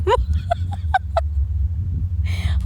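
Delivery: airy, high-pitched laughter; loud high laugh. Real, not acted. Laughing too loud at the viewer's name, breathless laugh. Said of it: "Oh my God, I'm too loud".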